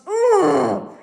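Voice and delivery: loud, high exasperated cry; angry cry. Real, not acted. Fed up with the clinic's app, loud angry ugh. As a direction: wail out the frustration. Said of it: "I'm so angry".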